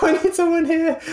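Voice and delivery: high, urgent cry for help; a junior doctor calling out, scared and high-pitched; panicked call for help. Retold or imitated. Junior doctor pulling the crash bell, high and urgent. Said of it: "I was like".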